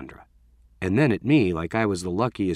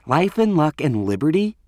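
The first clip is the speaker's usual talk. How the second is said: higher-pitched, scornful quote; disgusted quoting; 6 semitones higher, 4 dB louder than usual. A narrator's reading. Renee quotes the boy's note to friends, with disgust. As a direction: read it out as if it disgusts you. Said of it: "She curled her lip in disgust".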